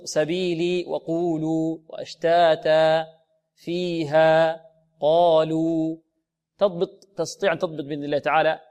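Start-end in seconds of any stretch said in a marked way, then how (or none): 0.0-6.0 slow even chant from سبيلي to قالوا; مد طبيعي. Shown as a demonstration. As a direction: give each long vowel in فيها قالوا the same two beats. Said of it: "وبعد أن تضبط". (سبيلي وقولوا وفيها قالوا بمد موزون)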